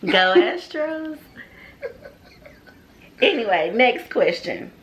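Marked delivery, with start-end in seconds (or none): 0.0-1.2 high, slow drawn-out cheer; school cheer. Real, not acted. School cheer, answering his Eagles.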